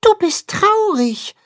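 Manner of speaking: very high, gentle little mole; tender mole voice. Acted. Mole Max gently tells the carp he is sad, tender voice. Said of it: "mit zarter Stimme".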